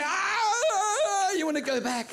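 high, loud mock wail; whining fifty. Fifty dollar bill begging to go back, high mock wail.

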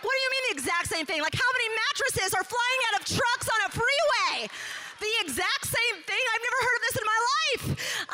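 can't believe what she's hearing; incredulous disbelief. Retold or imitated. Stunned new employee questions her boss's father in disbelief.

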